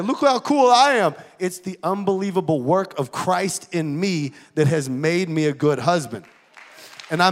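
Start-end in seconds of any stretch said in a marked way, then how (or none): 0.0-1.1 louder, higher mock boast; mock brag about being cool; bragging. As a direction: show off like a braggart. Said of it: "toot my horn and be like".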